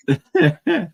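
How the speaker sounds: short high laugh; laugh, rising at the end; quick burst of high laughter. Real, not acted. Group laughing at story.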